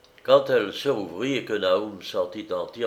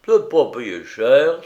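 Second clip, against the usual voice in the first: loud, slow, pleased with the bargain; self-satisfied merchant crowing; gloating; 4 semitones higher, 10 dB louder, slower than usual. A narrator's reading. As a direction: savour the cheap deal. Merchant gloats over his bargain.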